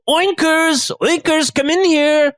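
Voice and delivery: high, singsong summons; chef calling pig. Acted. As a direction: call out to a pet pig in a high voice. Acts the chef calling his pig.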